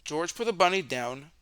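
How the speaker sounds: usual speaking voice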